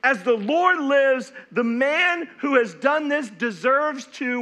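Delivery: loud, slow furious oath; angry vow. Acted. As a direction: swear it in a rage. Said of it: "David's anger was greatly kindled".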